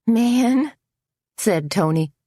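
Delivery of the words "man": goofy. A narrator's reading. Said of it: "giving a goofy smile".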